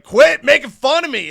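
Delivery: high, loud outburst of annoyance; A J Styles, pissed. Acted. Wrestler being laughed at in locker room, pissed off. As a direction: snap back at someone teasing you. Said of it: "A J's getting pissed".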